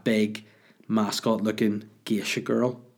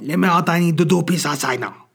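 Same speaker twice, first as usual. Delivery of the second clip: put-on loud, higher Chinese-accented dog yell; mock Chinese accent, shouting; 7 semitones higher, 6 dB louder than usual. Dog begging owner to let him out, shouted mock Chinese accent. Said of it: "This is the way I talk".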